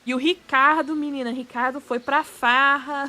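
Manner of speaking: loud, animated gossip in Brazilian Portuguese. Acted. Streamer voicing Brazilians gossiping about Ricardo, loud animated gossip. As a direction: spill juicy gossip loudly, Brazilian Portuguese. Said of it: "if you say their name with a Brazilian accent".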